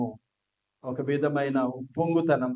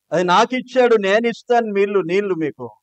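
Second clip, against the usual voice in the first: higher, louder boast of his own power; proud boast; 4 semitones higher, 5 dB louder than usual. Retold or imitated. Moses boasting to the people in proud anger. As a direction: crow about the power you were given. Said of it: "ఈ అధికారాన్ని ఎట్లా ఉపయోగించుకుంటున్నాడు".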